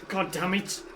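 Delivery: ordinary delivery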